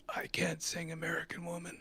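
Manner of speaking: hushed, breathy put-on voice; whispered refusal. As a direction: confess it under your breath. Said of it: "he says".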